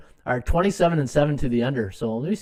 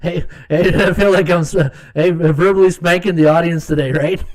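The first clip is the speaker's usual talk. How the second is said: usual speaking voice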